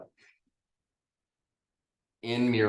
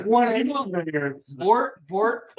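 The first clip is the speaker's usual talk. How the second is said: mock horror; 11 semitones higher, 7 dB louder, slower than usual. Real, not acted.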